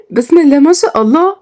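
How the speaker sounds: high-pitched joyful exclamation; thrilled high-pitched girl; amazed delight. Acted. Girl learns of the pregnancy.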